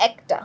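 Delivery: একটা with open "æ", as ækta; open vowel. Shown as a demonstration. Teacher gives the example word, open vowel. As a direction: begin একটা with the vowel of "cat". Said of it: "এর মতো উচ্চারণ হচ্ছে".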